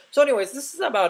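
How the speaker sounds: high, loud, quick sign-off that cracks; real voice crack while wrapping up. Real, not acted. Gamer wrapping up the episode, voice cracking. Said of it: "if my voice cracked there".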